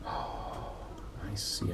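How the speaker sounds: usual speaking voice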